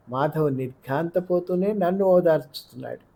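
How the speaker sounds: ordinary delivery, nothing marked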